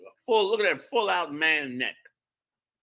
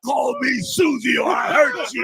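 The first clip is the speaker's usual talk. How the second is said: mock threat shout; 6 semitones higher, 4 dB louder than usual. Parodying the customer.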